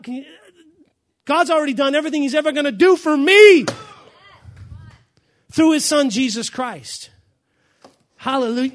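loud, high, fired-up declaration, slowing on "I am sick" and "Jesus Christ"; preaching at full force. Acted. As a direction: proclaim it to the congregation at full volume.

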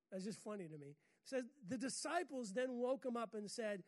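normal talking voice

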